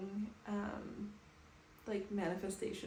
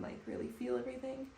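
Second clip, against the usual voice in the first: normal talking voice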